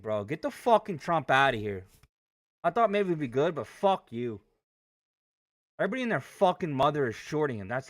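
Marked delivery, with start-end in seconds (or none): none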